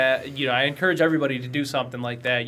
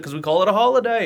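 higher-pitched mock Australian reply; bad Australian accent; 6 semitones higher than usual. Local answers the puzzled visitor, bad Australian accent. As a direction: imitate an Australian explaining himself. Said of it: "my worst Australian accent".

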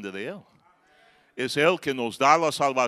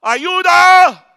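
high, loud cry for help; drowning man yelling; desperate shout; 13 semitones higher, 16 dB louder, much slower than usual. Retold or imitated. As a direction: scream for help from the water. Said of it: "Yo empecé a gritar".

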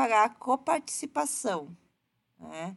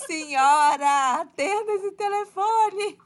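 exasperated shout; 9 semitones higher, 5 dB louder than usual. Pretend. Exasperated at unanswered phone.

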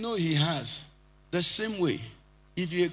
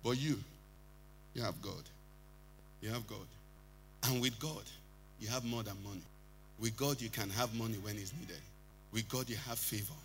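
much softer and lower, each "With God" laid down gently; soft, intimate preaching; 7 semitones lower, 12 dB quieter than usual. Acted. Pastor assuring believers of God's gifts, softly and intimately. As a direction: draw them in close, quiet and certain.

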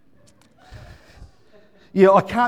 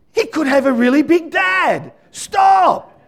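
alarmed protest; 9 semitones higher, 5 dB louder, slower than usual. Retold or imitated. Acting out his alarm at wife.

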